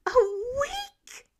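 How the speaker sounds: week shoots up very high in outraged disbelief; a cracked, squeaky protest at being benched; incredulous squeak. Acted. Repeats protest at week's rest.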